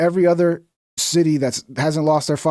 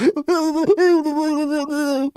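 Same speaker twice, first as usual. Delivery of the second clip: high laugh; 13 semitones higher than usual. Real, not acted. Laughing at the clip.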